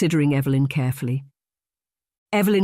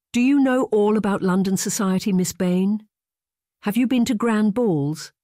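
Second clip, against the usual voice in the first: put-on higher-pitched girlish questions; eager curiosity; 6 semitones higher than usual. A narrator's reading. Girl quizzes her governess about London society, eagerly curious. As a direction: ask eagerly, pitch lifted, hungry to hear more. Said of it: "the eager curiosity in Julia's voice".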